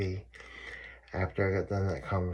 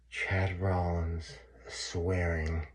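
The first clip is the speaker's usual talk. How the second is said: slow hushed mock log entry; mock solemn entry; much slower than usual. Mimicking himself writing his name in the book. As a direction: read it out like a dull confession.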